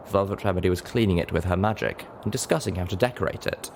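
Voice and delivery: usual speaking voice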